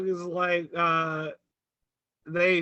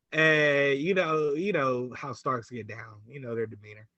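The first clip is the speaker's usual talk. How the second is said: quiet aside; 8 semitones lower, 7 dB quieter than usual. Real, not acted. Trailing low aside.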